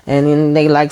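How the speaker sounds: ordinary talk, nothing marked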